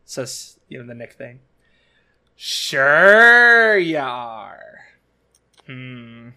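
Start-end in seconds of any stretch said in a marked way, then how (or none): 2.3-4.8 slow, drawn-out sarcastic quote. Retold or imitated. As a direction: drag it out dryly. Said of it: "say it in that tone".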